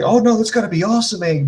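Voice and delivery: mock enthusiasm of the crowd; everybody's hype. Everybody talking up the bachelor party, mock-eager. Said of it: "Everybody's like".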